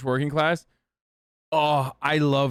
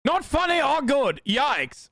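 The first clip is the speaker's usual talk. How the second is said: high, put-on British accent; squeaky-high mock British voice; mock British critic; 10 semitones higher than usual. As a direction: mimic a disapproving Brit in a raised pitch. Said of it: "Another fucking Brit".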